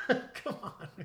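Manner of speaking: laughing through the words. Real, not acted. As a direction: chuckle while protesting. Laughs at Mike jab.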